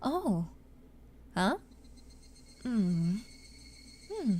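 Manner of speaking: short game-style reaction noises; quick character blips, one per click; little voice cues. Shown as a demonstration. Streamer demoing game voice cues. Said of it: "a little voice cue every time".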